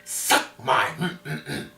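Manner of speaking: slow whispered mocking taunt. Girl whispering a slow taunt at classmate. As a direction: breathe the tease out in a whisper.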